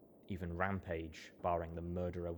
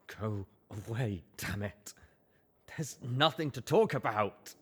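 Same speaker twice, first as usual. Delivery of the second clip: trembling voice; 3 semitones higher, 5 dB louder than usual. Acted. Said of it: "he said in a trembling voice".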